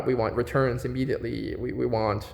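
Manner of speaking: ordinary talk, nothing marked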